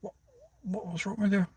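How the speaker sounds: quietly aghast, wondering what's wrong with her; mock baffled mutter. Thinking at the Tesla woman, quietly exasperated. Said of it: "I was like".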